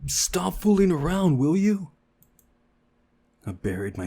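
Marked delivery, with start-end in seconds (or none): 0.1-1.9 much higher, louder exasperated shout; annoyed shout. Acted. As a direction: yell at them to cut it out. Girl snaps at pretending friend.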